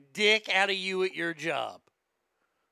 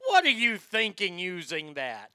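loud, scolding mock infomercial host; condescending pitchman; 8 dB louder, slower than usual. Infomercial guy scolding Gail for her product choice, condescending. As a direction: talk down to her, punching the opening. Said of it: "he is so fucking just talks down".

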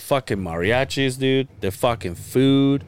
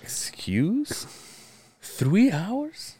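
whispered "excuse", then high questioning squeak; mock disbelief; 11 semitones higher, much slower than usual. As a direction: act stunned by the wait. Retold disbelief at three-hour wait.

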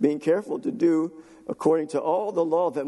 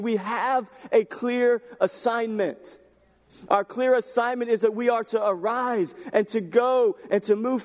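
higher, forceful sermon delivery; impassioned preaching; 6 semitones higher than usual. Real, not acted. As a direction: drive each phrase home to the congregation.

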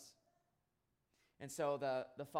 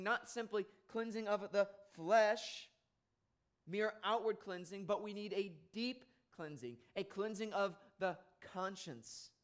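raised, higher, louder preacher's voice building to a peak; impassioned preaching; 6 semitones higher, 4 dB louder than usual. Acted. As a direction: press the point home from the pulpit. Preaching at full force.

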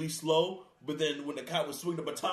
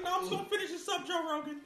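high squeaky crackhead voice; 13 semitones higher, 3 dB quieter, faster than usual. Retold or imitated.

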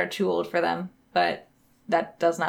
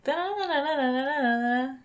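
sung guitar riff, da-da-da; vocal guitar riff; 4 semitones higher, 6 dB quieter than usual. Shown as a demonstration. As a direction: hum the guitar line in syllables. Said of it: "trying to do the guitar part".

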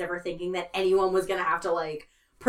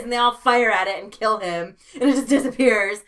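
usual speaking voice

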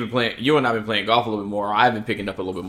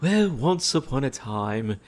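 storybook narration. Acted. Starts a mock fairy tale.

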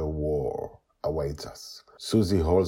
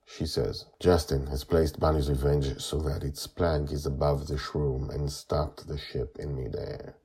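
plain reading voice

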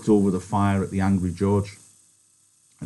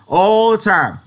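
louder, stressing "the time"; louder angry shout; 13 semitones higher, 9 dB louder, slower than usual. Acted. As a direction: repeat it even harder. Repeated louder.

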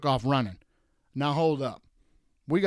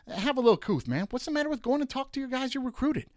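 pitched way up, pleading and fed up; exasperated high plea; 11 semitones higher than usual. Real, not acted. Host pleading with coaches to show class, exasperated and high. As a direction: throw your hands up and beg him for some decency.

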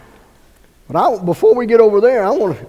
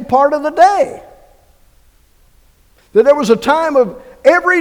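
higher, louder wondering preacher voice; fervent preaching; 7 semitones higher, 5 dB louder than usual. Acted. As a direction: marvel aloud to the congregation.

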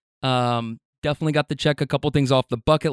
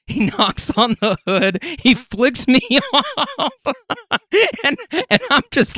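laughing; 10 semitones higher than usual. Real, not acted. Laughing while telling.

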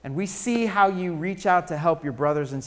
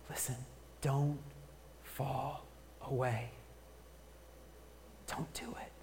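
urgent whisper; 5 semitones lower, 18 dB quieter than usual. Real, not acted. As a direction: drop to a pleading whisper. Pastor pleading with congregation.

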